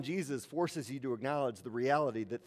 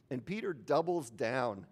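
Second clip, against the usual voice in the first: usual speaking voice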